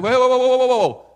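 high, loud mock panic; hammed-up demons backing off in alarm; shouted whoas like startled demons seeing Jesus; panicked whoa. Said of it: "They see him coming, like".

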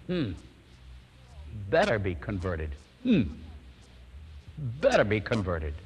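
low, grudging mutter; lightly voiced sour woman, low and unconvinced; sceptical wife. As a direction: doubt it darkly, a hint of a hard wife. Sceptical wife's grudging reply.

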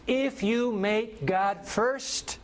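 each word drawn out, slow and weighty; slow emphatic preaching. Acted. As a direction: deliver the key truth word by word. Preaching the key point.

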